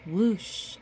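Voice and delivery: ordinary delivery, nothing marked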